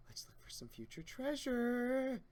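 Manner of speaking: high, slow dreamy voice; slow sing-song character. Acted. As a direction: dream aloud slowly.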